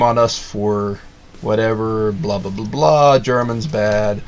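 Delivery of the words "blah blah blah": usual speaking voice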